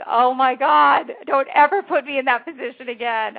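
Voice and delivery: imitating a partner pleading never again; exasperated plea. Partner scolding the manager after the interview, loud mock exasperation. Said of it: "Palestina was just like".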